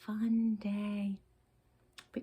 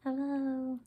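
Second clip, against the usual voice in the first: 1 puppet voice; 6 semitones higher, 6 dB louder than usual. Pretend. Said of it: "Say hello, Blue".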